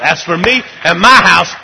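climbing from firm to a full shout; rising to a shout. Acted. Preacher crying out to the congregation, building into a shout. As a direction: build it till you're shouting.

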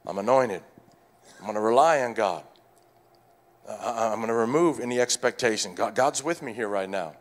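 low, soft self-reassurance; steady yourself quietly with a declaration of faith; calming oneself. Acted. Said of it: "You gotta calm yourself".

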